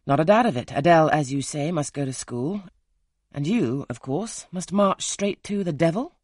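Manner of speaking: loud mocking retort; sarcastic outburst. A narrator's reading. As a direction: throw the words back at her, scornful and loud.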